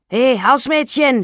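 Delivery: loud, high, drawn-out call; shouting. A narrator's reading. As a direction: call out sharply for the maid. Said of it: "schrie er". (laut rufend, schreiend)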